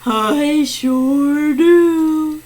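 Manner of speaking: very drawn-out put-on voice; slow-talking contestant. Player three answering the host about art, slow. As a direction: stretch out every word.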